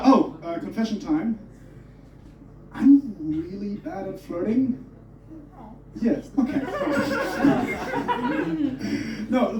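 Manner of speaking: ordinary talk, nothing marked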